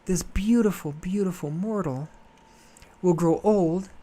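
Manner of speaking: lightly voiced Selene in mock despair; mock distressed goddess. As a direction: wail it like a melodramatic goddess. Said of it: "she's like".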